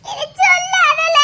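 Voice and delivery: high, drawn-out whine; childish plea not to tell; whining plea. Acted. Pupil pleads against being told on.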